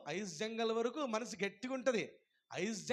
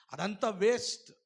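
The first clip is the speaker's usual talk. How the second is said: ordinary talk, nothing marked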